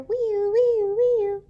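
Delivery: loud, high siren wail; siren noise. Acted. Luther racing to the crash site, a loud high siren wail. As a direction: wail like a fire engine.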